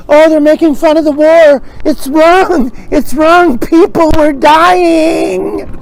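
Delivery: high, loud hammed-up indignant complainer; mock outrage. Offended moviegoer protesting jokes about war, mock outrage. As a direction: wail it in pearl-clutching outrage, stretching the end. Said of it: "Somebody would say".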